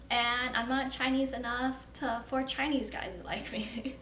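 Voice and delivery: ordinary delivery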